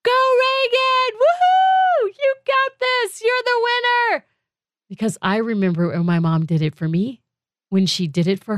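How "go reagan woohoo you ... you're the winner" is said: much higher, slow, loud cheering whoops; stretched-out mock stadium cheer, pitched way up; screaming loud. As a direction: whoop for your kid on stage like a crazy loud mom. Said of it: "I'm screaming loud in every video".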